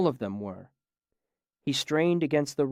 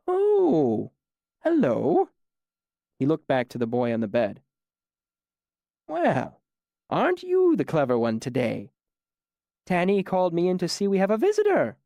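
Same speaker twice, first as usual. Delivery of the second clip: put-on higher, patient old man voice, mildly surprised; friendly old man; 5 semitones higher, 4 dB louder than usual. A narrator's reading. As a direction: greet the stranger kindly. Old man greets a visitor.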